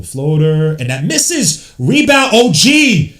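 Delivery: hyped fan commentary climbing into high, loud shouts; excited basketball call building to a yell; live play-by-play. Real, not acted. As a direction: call the game, then explode on the big shot.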